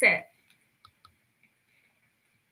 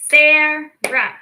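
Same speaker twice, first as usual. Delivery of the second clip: Sarah said louder in two clapped beats, Sa-rah; clapped syllables; 4 semitones higher, 6 dB louder than usual. Shown as a demonstration. Teacher demonstrating name syllables, clapping Sarah. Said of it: "you can also do it with your name".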